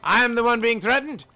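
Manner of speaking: high, defensive protest; indignant boss. Acted. Cornered CEO turning away a visitor, high and indignant.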